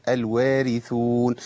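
الوارثون slowly, with a thin light r; الوارثون drawn out, r unemphasised. Shown as a demonstration. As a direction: recite الوارثون with the r kept light. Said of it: "الراء هنا مرققة".

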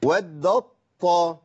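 ودت طا said very slowly, the ت fully merged into a stressed ط; very slow demonstration of the merged ط; slow tajweed demo. Shown as a demonstration. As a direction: drag out ودت طا, the t swallowed into a doubled ط. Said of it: "لو قلت".

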